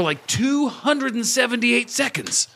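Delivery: high, drawn-out emphasis on the number; high, stretched-out excited voice; excited gloating. Acted. Gloats off the recording, dropping meditation voice.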